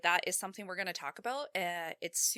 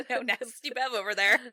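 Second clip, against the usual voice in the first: louder, laughing through the words; 4 semitones higher, 9 dB louder than usual. Real, not acted. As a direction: giggle while teasing. Teasing, laughing out loud.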